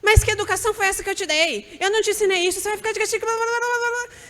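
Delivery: hammed-up exasperated mom telling off her son; scolding parent. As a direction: cut the child off and lecture. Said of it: "você já começa".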